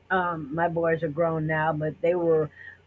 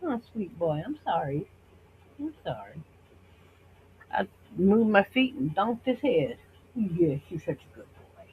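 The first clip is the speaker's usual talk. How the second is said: softer, tender sorry to the dog she bumped; soft apology to dog; 4 dB quieter than usual. Real, not acted. To her dog after bumping his head, soft and tender.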